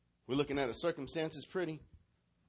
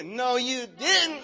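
high, slow, sassy retort; with attitude; 9 semitones higher, 6 dB louder, much slower than usual. Shown as a demonstration. Preacher leads all of them to repeat it, with attitude. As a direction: drag it out high and loud. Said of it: "Say it again with attitude".

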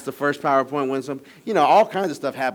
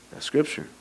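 whisper; 3 semitones higher, 4 dB quieter than usual. Real, not acted. Aside after quoting verse.

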